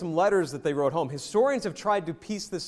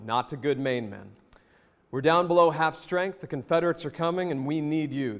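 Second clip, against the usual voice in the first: formal speech; 3 semitones lower than usual. Acted. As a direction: deliver it like a solemn colonel before battle. Reads Chamberlain's speech.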